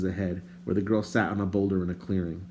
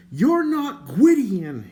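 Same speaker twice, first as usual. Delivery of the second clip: loud, high, slow accusing yell; angry shout; 14 semitones higher, 7 dB louder, much slower than usual. A narrator's reading. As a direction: shout it at the stranger in anger. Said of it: "he shouted".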